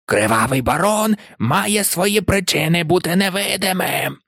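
strained, hissing pronouncement; hoarse hiss. A narrator's reading. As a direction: rasp it out like an imperious ghost. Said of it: "засичав він хрипко".

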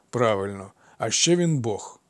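plain reading voice

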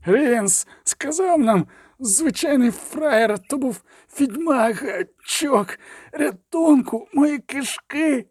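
high, strained, slow dying wail; agonised moaning. A narrator's reading. Gutted killer begs for rescue, agonised strained wail. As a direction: force the words out through gut pain.

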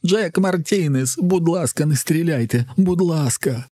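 high, terrified begging at gunpoint; near-screeching plea. A narrator's reading. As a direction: beg for your life in a high voice. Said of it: "мало не верещить він". (перелякане благання, майже вереск)

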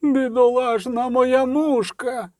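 slow, high-pitched howl of grief; wailing. Acted. Market woman over her mangled little dog, wailing. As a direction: wail it out, drawing the words long. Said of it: "завила раптом торговка".

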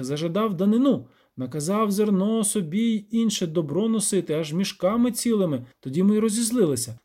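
lightly voiced peasant, high and lively; villager telling troubles. Villager complaining of the devil, lightly acted peasant voice.

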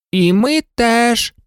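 high, drawn-out doll voices; chorusing dolls. A narrator's reading. Three performer dolls agreeing together, high singsong chime. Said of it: "як завжди хором відповіли всі три ляльки-артистки".

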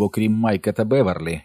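ordinary delivery, nothing marked

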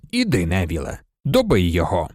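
low-pitched, mild command; understated low instruction; ordering. A narrator's reading. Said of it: "звелів Люпин".

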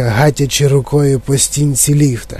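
plain reading voice

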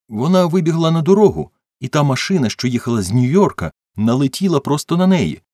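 high, loud, flustered account; agitated witness. A narrator's reading. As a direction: tell the accident urgently, pitch well up. Agitated witness describes the accident. (схвильована розповідь свідка)

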